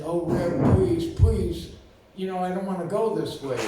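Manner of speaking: hammed-up plea; mock pleading. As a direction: plead for mercy, half joking. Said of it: "And I said".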